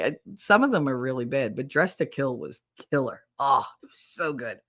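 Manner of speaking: ordinary talk, nothing marked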